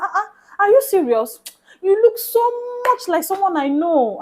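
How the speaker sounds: high, loud astonished quote; surprised disbelief. Retold or imitated. Stranger insisting to the narrator, high surprised disbelief. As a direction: mimic the stranger's shocked surprise.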